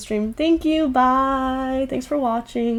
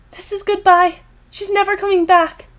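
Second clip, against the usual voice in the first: higher, pained inner voice; tearful heroine; 8 semitones higher than usual. Acted. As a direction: let it break into tears.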